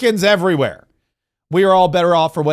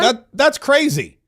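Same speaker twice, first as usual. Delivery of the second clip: slow, mocking "that's crazy" with "that's" stressed; drawn-out sarcastic echo of "crazy"; mock repeat; 5 semitones higher, slower than usual. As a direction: drag out "That, that's crazy" to ridicule it. Mockingly repeating Bondi's quote.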